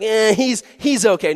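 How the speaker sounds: high, loud shrugging excuse; dismissive owner. Retold or imitated. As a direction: shrug the bite off. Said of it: "they're like".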